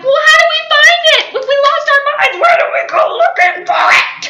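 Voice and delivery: high loud fast shouting; mock-earnest shouted question; giddy loud protest. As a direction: yell the silly logic.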